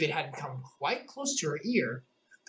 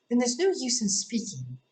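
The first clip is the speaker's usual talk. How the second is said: Alice voice; 8 semitones higher, 3 dB louder than usual. A narrator's reading.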